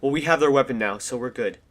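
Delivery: usual speaking voice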